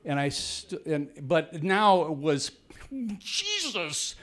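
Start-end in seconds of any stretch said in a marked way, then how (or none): 3.2-4.1 high sudden cry of pain; a real jolt of shock; startled yelp. Retold or imitated. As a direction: yelp it out as the sting hits. Sudden scorpion sting on lap.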